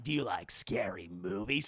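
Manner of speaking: very low stalker voice; mock menacing caller. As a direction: imitate a creepy phone killer, pitched deep. Voicing the killer caller.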